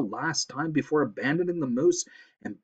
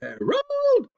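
very high screech for her husband; piercing, high-pitched holler; screamed; 4 dB louder than usual. A narrator's reading. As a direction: scream the name out long. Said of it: "Missus Blevins screamed".

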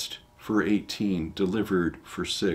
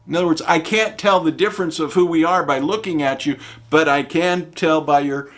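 loud, higher mock command; commanding Jephthah; 6 semitones higher, 7 dB louder than usual. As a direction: lay down the deadly test. Jephthah's Shibboleth order.

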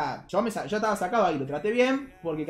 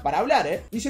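normal talking voice

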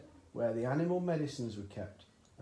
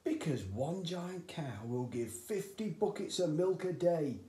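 a little higher, eager cry; excited cry; 6 semitones higher than usual. A narrator's reading. Father boasting to his son, mildly raised. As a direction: blurt out the wonder of it. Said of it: "cried Mister Cranky".

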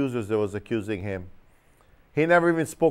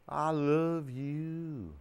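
mock tender; 2 semitones higher, 8 dB quieter, much slower than usual. Voicing a call to an enemy.

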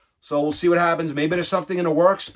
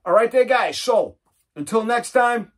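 cheerful sign-off; 6 semitones higher, 3 dB louder than usual. Real, not acted. Upbeat sign-off to viewers.

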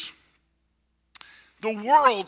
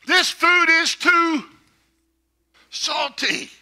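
slow, high, louder complaint; mock complaining salt hater; 8 semitones higher, 4 dB louder, slower than usual. Preacher mimicking salt haters, in a slow high whine. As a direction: whine it like a fussy diner. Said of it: "salt haters say this".

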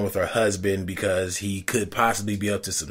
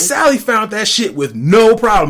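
loud, high-pitched exasperation; worked-up ranting; 11 semitones higher, 7 dB louder than usual. Real, not acted. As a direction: hammer home the absurdity, voice way up. Indignant rant about plot hole.

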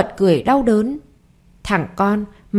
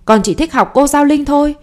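put-on higher-pitched boy insisting; boy defying his mother, higher voice; defiant child; 6 semitones higher, 3 dB louder than usual. A narrator's reading. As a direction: whine it like a stubborn kid. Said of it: "nó đẩy mạnh cô hơn".

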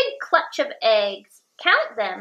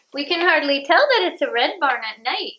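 usual speaking voice

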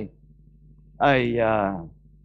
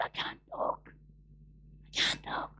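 imitated voiceless whisper; lost voice; 20 dB quieter than usual. Man with ruined vocals refusing to teach, voiceless whisper. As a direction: barely get the words out, all air. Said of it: "it destroyed all his vocals, and he couldn't speak".

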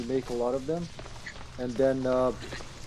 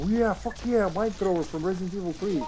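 higher, mock-excited cheer; mock hype; 5 semitones higher than usual. Gamer mocking fans hyped for a weapon, sarcastic cheer. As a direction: fake a fan's hype, tongue in cheek. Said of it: "I never heard anyone go".

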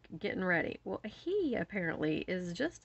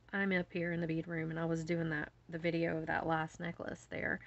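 ordinary talk, nothing marked

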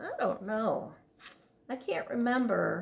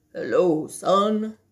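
lower, louder fatherly greeting; dad voice; 3 semitones lower, 7 dB louder, slower than usual. Acted. Dad greeting his son in a lower, louder voice. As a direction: answer as a big dad. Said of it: "that's his dad".

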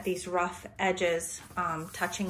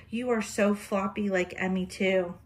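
ordinary talk

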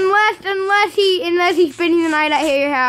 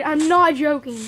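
ordinary delivery

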